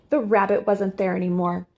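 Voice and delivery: usual speaking voice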